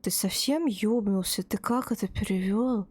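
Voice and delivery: ordinary talk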